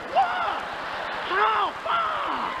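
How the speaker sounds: high shocked yelps; mock panic. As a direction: squeal it out in mock alarm.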